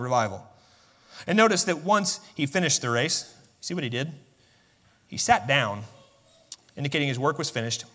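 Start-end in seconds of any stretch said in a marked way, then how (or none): none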